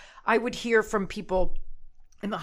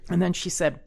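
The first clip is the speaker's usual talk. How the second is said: ordinary talk, nothing marked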